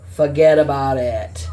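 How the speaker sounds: put-on a breathy whispered Boston-accented "Forget about it". Reader tries the phrase again in a whispered Boston accent. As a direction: whisper it like a Bostonian letting it slide. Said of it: "Sounding like they're, like, from Boston".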